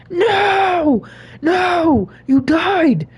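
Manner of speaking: high, loud hammed-up cry of despair; mock anguished wail. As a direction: wail over the dead phone like a lost friend. Phone died, mock wail.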